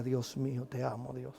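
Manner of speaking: very soft, low private prayer; hushed devotion. Real, not acted. Moved by the memory, praying to God, hushed devotion. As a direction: drop to a murmured prayer under the breath.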